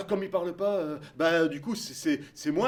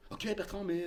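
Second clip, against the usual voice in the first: ordinary talk, nothing marked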